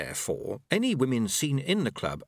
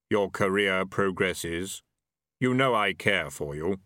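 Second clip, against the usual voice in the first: slow, low reaper voice; unhurried Death, every word weighed; grim reaper voice; 3 semitones lower, slower than usual. A narrator's reading.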